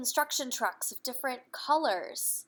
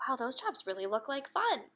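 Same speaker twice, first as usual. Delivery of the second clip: ordinary delivery, nothing marked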